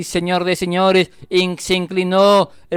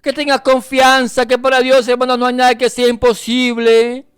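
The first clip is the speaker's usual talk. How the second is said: impassioned preaching; 6 semitones higher, 5 dB louder than usual. Real, not acted. Preacher urging listeners to get up and trust, impassioned. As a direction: rouse the congregation with insistent force. Exhorts congregation at full force.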